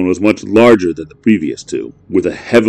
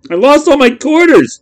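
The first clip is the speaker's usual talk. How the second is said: put-on high, loud wailing kid; crying kid; 6 dB louder than usual. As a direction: cry out the loss. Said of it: "he cried".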